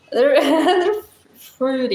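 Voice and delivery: usual speaking voice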